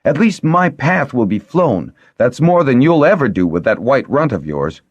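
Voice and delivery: high, loud angry retort; furious outburst, pitched high; exploding in anger. A narrator's reading. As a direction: fire back a stinging taunt. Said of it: "Miriam exploded".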